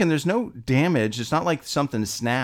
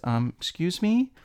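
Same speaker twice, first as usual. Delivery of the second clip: sweet classmate; 7 semitones higher than usual. Retold or imitated. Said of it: "she sweetly raises her hand".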